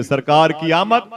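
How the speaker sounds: slow, loud, joyful call. Shown as a demonstration. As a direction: lead the crowd in a happy chant. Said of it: "जरा खुश हो जाओ और बोल दो फिर से". (खुशी से धीमा, बुलंद नारा)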